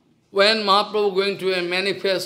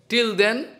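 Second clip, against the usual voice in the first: normal talking voice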